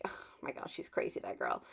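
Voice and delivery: very soft, unvoiced-sounding whispery aside; imagined viewer's aside. Viewer thinking to themselves about the crafter, hushed aside. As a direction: whisper it like a viewer's private judgement.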